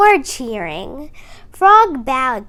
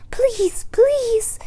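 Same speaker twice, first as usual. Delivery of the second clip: begging; 10 semitones higher than usual. A narrator's reading. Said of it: "the mother bird begged".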